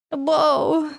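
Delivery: high squealed boo; startling boo. Pretend. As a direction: jump out with a high cry. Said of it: "Did I spook you?".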